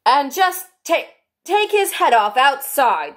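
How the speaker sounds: high, loud, slow royal order; drawn-out, imperious command; Queen. A narrator's reading. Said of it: "the Queen added to one of the officers".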